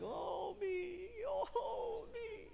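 high mock wail of self-pity; mock whining. Preacher mocking his own pity party in a high wail. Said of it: "you're like".

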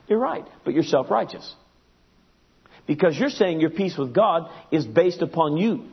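usual speaking voice

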